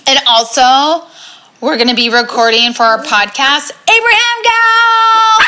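loud announcing call; yelling across the room. Real, not acted.

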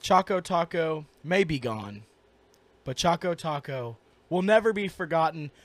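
low, soft, solemn memorial speech; mock eulogy. Co-host eulogizes the lost Choco Taco, mock solemn. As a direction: deliver a grave funeral tribute in jest.

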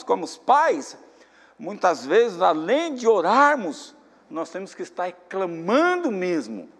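loud, ringing sermon voice, stretched phrases; preaching at full force. Acted. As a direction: exhort the church, slowly and forcefully. Preacher exhorting the congregation.

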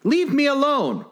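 put-on high indignant little-girl demand. A narrator's reading. Alice warding off the pigeon, high indignant voice. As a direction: push the pigeon away in a high voice. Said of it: "said Alice indignantly".

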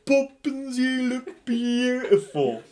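high, loud, slow stage Cockney; over-the-top Cockney compliment; hammed-up Cockney. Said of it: "this is how it really sounds".

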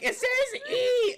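hammed-up high panic; mock panic. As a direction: imitate her wailing in alarm, drawn out. Acting out panicked friend's cry.

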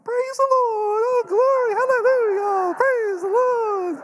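very high, loud, crying praise; grandma Kathy impression. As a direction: shout praise like an ecstatic grandma. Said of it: "do you see Nonna".

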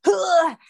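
loud, high effortful grunt; imitated big heave. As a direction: act out one really big push. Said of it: "this one really big".

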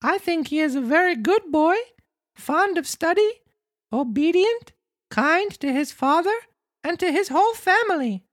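very high puppet voice; Pinocchio boasting. Acted. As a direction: praise in a squeaky little marionette voice.

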